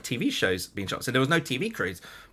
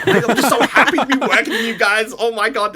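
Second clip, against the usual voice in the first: laughing, much higher and louder; giddy gushing; 10 semitones higher, 8 dB louder than usual. Retold or imitated. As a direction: bubble over with joy at the crew. Gushing to the crew.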